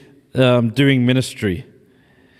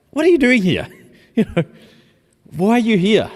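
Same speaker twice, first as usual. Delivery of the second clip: accusing; 8 semitones higher, faster than usual. Shown as a demonstration. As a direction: throw the question at him like a reproach. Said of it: "It can be read accusingly".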